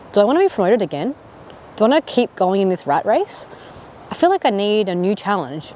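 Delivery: ordinary delivery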